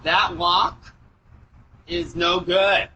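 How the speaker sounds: slow halting doorbell camera audio; drawn-out, hesitant words over a doorbell mic; doorbell speaker. Real, not acted. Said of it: "his words get even stranger".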